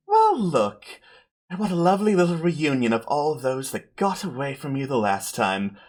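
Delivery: smug villain savouring the reunion; gloating villainess Zafira. Acted. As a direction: purr a mocking welcome as the villainess. Zafira looks in, chuckles, taunts party.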